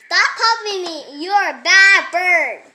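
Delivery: loud, with laughter breaking through; loud laughing scold. Real, not acted. Kid yells at the copycat bird, half laughing. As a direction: yell at the bird while cracking up.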